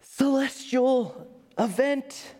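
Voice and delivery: high, slow, breathless awe; awestruck whisper. Retold or imitated. Scientist floating before a forming galaxy, high slow awestruck. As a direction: marvel at the galaxy being born. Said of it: "she says".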